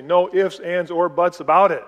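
loud, emphatic mom's ultimatum, imitated; retold no-nonsense parental order; stern mom. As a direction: lay down the law like a mother. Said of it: "my mom would say".

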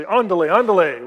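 loud, urgent retold Spanish command; emphatic Spanish hurry-up call; dad hurrying kids. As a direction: imitate a dad hurrying everyone along. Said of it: "use his Spanish and say".

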